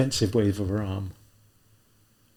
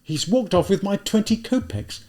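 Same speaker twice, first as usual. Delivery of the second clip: vicious irritation; 10 semitones higher, 3 dB louder than usual. Acted. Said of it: "with vicious irritation".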